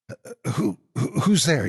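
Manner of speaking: high stammering call, voice catching; frightened call. A narrator's reading. Scared boy calling out to the door, stammering. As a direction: call out through the door in fear. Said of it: "he called".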